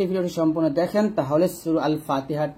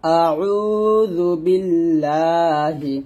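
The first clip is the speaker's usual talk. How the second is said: slow chanted আউযু বিল্লাহি; recited together; much slower than usual. Shown as a demonstration. Teacher leads viewers to recite together, slow chant. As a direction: intone it slowly. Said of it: "তাহলে একসাথে বলেন".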